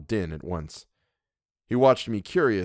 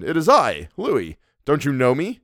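louder, higher pleading voice; Louis, anxious cousin; 6 semitones higher, 6 dB louder than usual. Acted. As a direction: reassure a friend who holds a knife.

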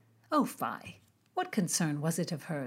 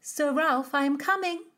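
high, loud call to a guest; raised voice answering from another room; 9 semitones higher, 6 dB louder than usual. Acted. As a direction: call out to Sir Ralph down the hall. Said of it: "Philomena called".

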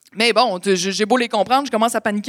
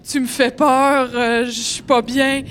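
higher, louder, slow scared pleading; frightened plea; 4 semitones higher, 5 dB louder, slower than usual. Retold or imitated. To the threatening man, scared slow plea. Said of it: "Mon réflexe, c'est de me confier à lui".

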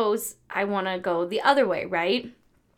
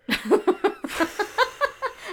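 a real high laugh bursting out; high burst of laughter; 10 semitones higher than usual. Real, not acted. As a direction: laugh freely at the teasing. Friends teasing about constant calls.

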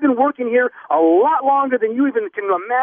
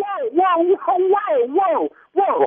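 high, startled string of whoas; alarmed interruption; 7 semitones higher, slower than usual. Real, not acted. Store worker stopping caller who mentioned a body, alarmed. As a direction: cut in with mounting alarm.

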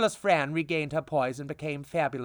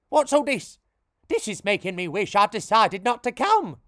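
indignant outburst; 4 semitones higher, 5 dB louder than usual. Acted. Outburst at the chaos.

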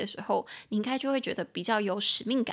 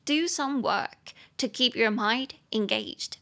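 slow model sentence; slower than usual. Shown as a demonstration. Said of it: "model sentence".

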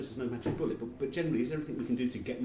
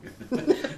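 short high nervous laugh; 8 dB louder than usual. Real, not acted. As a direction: laugh nervously, caught off guard. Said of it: "nervous laughter".

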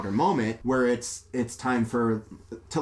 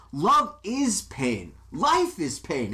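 slow, higher, hammed-up gravity; mock dramatic pronouncement; 7 semitones higher, much slower than usual. Ray lecturing the mother on love, slow mock drama. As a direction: draw it out like a brooding movie hero. Ray's dramatic line retold.

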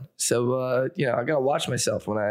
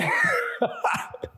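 high squealing laugh. Real, not acted. Reacts to joke about beating them.

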